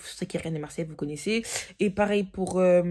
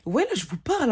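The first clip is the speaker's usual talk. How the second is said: talking like this; 7 semitones higher, 5 dB louder than usual. Shown as a demonstration. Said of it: "j'aurais pu parler comme ça?".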